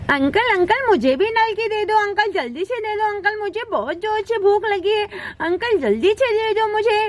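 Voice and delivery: put-on lisping childish pleading; babyish, insistent begging; impatient little child. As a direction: pester the seller like a hungry small child.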